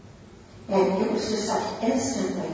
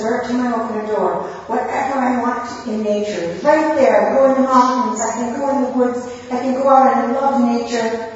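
louder and higher, building with delight; excited; 6 semitones higher, 6 dB louder than usual. Retold or imitated. Husband dreaming of nature doors, loud and excited. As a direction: burst out about mountains and woods.

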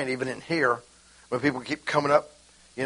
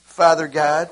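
loud prayer; 8 dB louder, slower than usual. Shown as a demonstration. Acted prayer letting go.

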